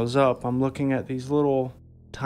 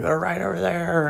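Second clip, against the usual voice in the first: urgent call; 6 semitones higher than usual. Retold or imitated. Boy shouting to searchers in woods.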